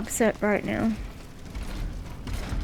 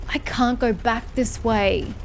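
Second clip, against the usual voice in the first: ordinary talk, nothing marked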